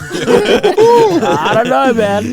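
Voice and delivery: high, slow laughing words; giggling out the words. Real, not acted. Laughing through a joke.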